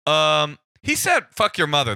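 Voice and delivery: embarrassed literal repeat; awkward interpreter. Retold or imitated. Interpreter relaying the insult to the cop, nervous. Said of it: "I go".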